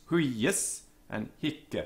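"Huius and hic" said slowly, leaning on the tense first syllables hu- and hic; slow, careful model of "Huius" and "hic" with tense vowels. Shown as a demonstration. Teacher models "Huius and hic" for young Latin learners, slow and deliberate. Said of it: "but it is tense".

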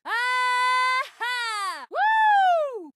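high, whooping cry of triumph; exultant high whoop; triumphant cheer. Acted. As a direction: whoop it out in victory.

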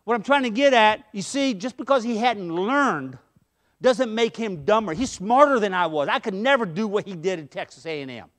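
usual speaking voice

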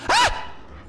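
sharp high breathy shriek of fright; jump-scare yelp; startled scream. Real, not acted. Sudden zombie scare.

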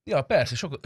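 ordinary delivery

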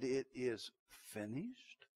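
slow, soft and low, fading out; hushed last words. Acted. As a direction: breathe it out like a dying man. Christ's words on the cross.

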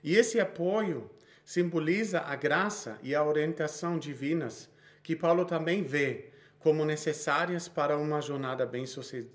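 usual speaking voice

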